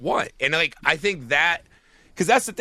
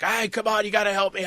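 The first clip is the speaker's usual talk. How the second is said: urgent call; 8 semitones higher, faster than usual. Retold or imitated. Vickus urging frozen prawn.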